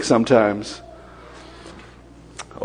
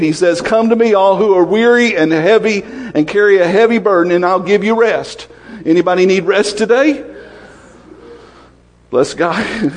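raised, fervent preaching voice; 4 semitones higher than usual. Acted. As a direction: preach it with rising fervor. Preaching builds to full force.

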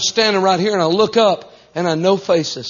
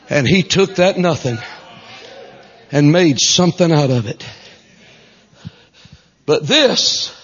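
ordinary talk, nothing marked

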